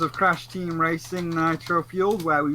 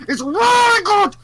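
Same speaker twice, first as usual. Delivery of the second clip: much higher and louder, gushing burst; excited squeal; 10 dB louder than usual. Real, not acted. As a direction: squeal it with delight. Gushing about a game.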